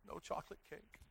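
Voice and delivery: whispered mock lament over cake; mock grief, whispered. Pastor to congregation, whispered mock lament over cake. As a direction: breathe it out in mock despair. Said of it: "Don't know why I'm so upset".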